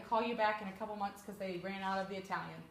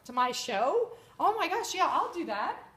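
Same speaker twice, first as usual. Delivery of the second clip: higher, eager and gushing; excited customer; 5 semitones higher, 4 dB louder than usual. Retold or imitated. Evelyn eagerly agreeing to book, high excited voice. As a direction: sound thrilled to book the party. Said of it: "Evelyn's like".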